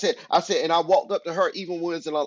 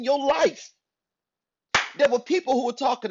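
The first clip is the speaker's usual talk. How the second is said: raised, forceful sermon delivery; emphatic preaching; 4 semitones higher than usual. Real, not acted. Preacher urging listeners beyond their hometowns, emphatic preaching. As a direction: drive each point home to the congregation.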